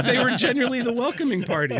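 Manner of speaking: speaking through laughter, quick; real laughter breaking through the line; laughing. Real, not acted. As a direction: get the words out while laughing. Laughing while explaining the twist.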